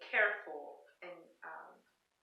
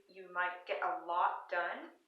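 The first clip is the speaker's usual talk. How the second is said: ordinary delivery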